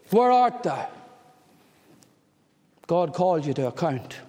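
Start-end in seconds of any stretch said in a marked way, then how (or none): 0.1-0.9 God calling out. Acted.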